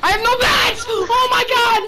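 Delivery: high, loud cry of dismay; panicked yelling. Real, not acted. Gamer realising there are no bats, panicked yelling.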